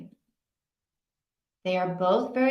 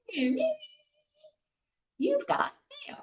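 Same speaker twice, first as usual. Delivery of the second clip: dial-up mail alert; 6 dB quieter than usual. Retold or imitated. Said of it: "Remember back in the early days of the internet".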